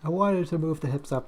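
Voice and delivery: ordinary talk, nothing marked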